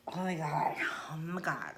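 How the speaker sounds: breathy, hushed exasperation; hushed oh my God to herself; whispered oh my God. Real, not acted. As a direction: mutter it under your breath, exasperated. Itchy nose mid-video.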